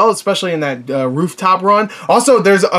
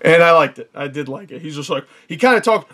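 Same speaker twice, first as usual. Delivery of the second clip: ordinary delivery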